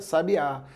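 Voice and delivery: sabiá, stressed on the final Á; stress on last syllable. Shown as a demonstration. Teacher contrasting same letters for students, stress on last syllable. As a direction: land on the end, sa-bi-Á. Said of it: "Se eu deslocar a sílaba tônica".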